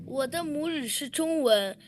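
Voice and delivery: ordinary talk, nothing marked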